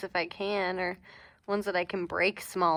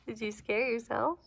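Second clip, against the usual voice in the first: ordinary talk, nothing marked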